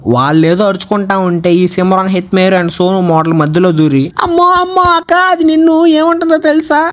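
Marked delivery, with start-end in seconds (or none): 4.2-6.9 high-pitched gossiping auntie voice; high gossipy voice. Retold or imitated. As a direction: stir up trouble in a high, sweet voice.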